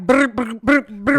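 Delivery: loud buzzing lip trill; jiggling belly noise. Retold or imitated. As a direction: blow a loud brr like flesh wobbling. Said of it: "she take them Spanx off and it be like".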